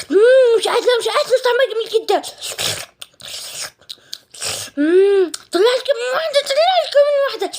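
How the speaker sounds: high, gushing over the taste; delighted eating. Acted. Girl sneaking the mango, delighted, high.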